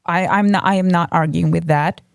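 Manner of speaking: ordinary talk, nothing marked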